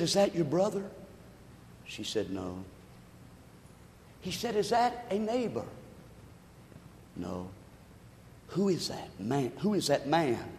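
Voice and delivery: soft, hushed story dialogue; a low, hushed graveside exchange; hushed retelling. Retold or imitated. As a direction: tell it quietly, drawing them in. Quiet retold dialogue at grave.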